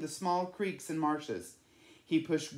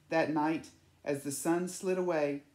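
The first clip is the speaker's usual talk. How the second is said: ordinary delivery, nothing marked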